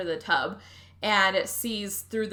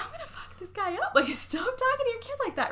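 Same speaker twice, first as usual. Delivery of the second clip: indignant; 14 semitones higher than usual. Real, not acted. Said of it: "I was like".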